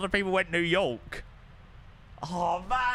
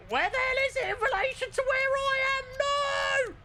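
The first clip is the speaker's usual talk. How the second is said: squeaky high exasperated question, pitched way up; frustrated squeal. Real, not acted. Streamer lost on the map, squeaky exasperated high question. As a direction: squeal it out while hunting the map.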